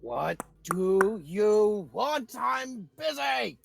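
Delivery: slow, annoyed, shouted "I'm busy"; grumpy creature yelling through the door; irritated shout. Acted. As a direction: drive the visitors off.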